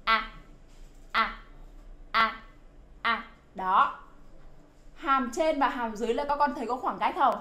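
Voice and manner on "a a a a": "A" clipped short, jaw open, lips spread wide; short open e bẹt sound; short crisp a. Shown as a demonstration. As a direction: snap out a short "A", no drawing it out. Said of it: "phát cái âm A ... một cách dứt khoát".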